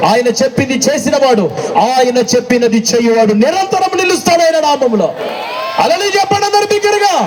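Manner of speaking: pushes the congregation to shout; full-force preaching. Acted. Climactic preaching call.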